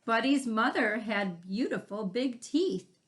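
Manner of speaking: usual speaking voice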